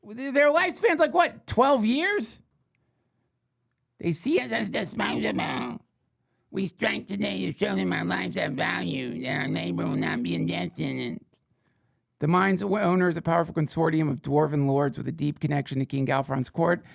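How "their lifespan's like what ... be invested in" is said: a small kobold striker stating its case. Acted. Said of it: "I'll do it in kobold voice".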